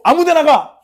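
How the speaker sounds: loud, high dismissive order; careless command. Acted. Teacher acting out random placement of the last card, loud careless command.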